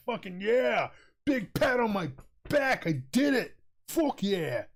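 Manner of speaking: re-enacted triumphant cheer, pitched high; proud cheering. Telling how they fixed the starter, proud celebratory cheer. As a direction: cheer yourself on, high and jubilant. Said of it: "I was so proud of myself".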